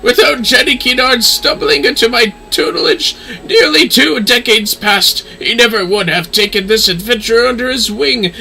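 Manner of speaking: condescending lady. Acted. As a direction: savour every word of your superiority. Lady lectures, slow and high.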